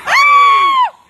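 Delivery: sudden loud high scream of fright; real scream. Real, not acted. Startled by the possessed friend, a loud scream. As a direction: let out a sharp frightened scream.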